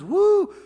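high, loud "woo"; amazed whoop. Acted. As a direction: whoop in acted astonishment. Disciples react to calmed storm.

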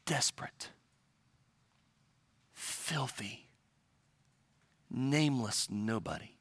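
low, very soft, word by word with long pauses; solemn hush. Real, not acted. Preacher describing the nameless woman, hushed. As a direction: let each word fall quietly and separately.

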